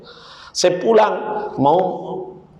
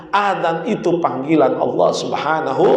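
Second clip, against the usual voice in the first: usual speaking voice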